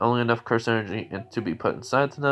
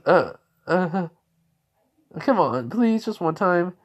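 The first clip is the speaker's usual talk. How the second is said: pleading whine; 7 semitones higher, slower than usual. Acted. Zenitsu begging to meet sister.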